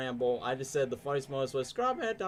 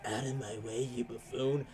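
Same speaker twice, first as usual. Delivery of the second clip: lightly voiced edgy pirate; a hint of an edgy anime pirate; 2 semitones lower, 6 dB quieter than usual. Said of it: "kinda sounds like an edgy pirate where he goes".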